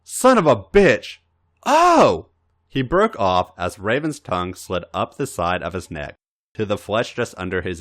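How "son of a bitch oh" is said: louder and higher, then a sharp high yelp on Oh; a loud curse that snaps into a startled cry; startled cursing outburst. A narrator's reading.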